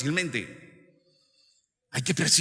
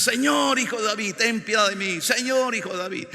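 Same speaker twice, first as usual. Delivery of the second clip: high, repeated plea; acted beggars' cry for mercy; calling from behind; 8 semitones higher, 4 dB louder than usual. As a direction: call out to someone ahead of you. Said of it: "Ellos atrás".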